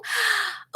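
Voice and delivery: sharp, high gasp of delight; a quick, high intake of breath; surprised gasp. Real, not acted. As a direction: gasp as if caught off guard by good news. Reads a fan's surprising message.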